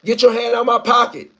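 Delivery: panicked yell for help, high and loud; pretend shout. Man yelling at a thief grabbing his pocket, loud and high. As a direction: cry out as if being pickpocketed. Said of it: "just wait till you hear somebody say".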